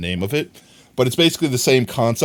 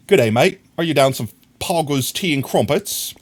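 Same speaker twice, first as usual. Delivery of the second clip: hammed-up southern English accent, a bit higher; mock English accent; 4 semitones higher than usual. Streamer reading chat in a mock English accent. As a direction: ham up a British accent. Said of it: "most southern, uh, English accent I could possibly rip off".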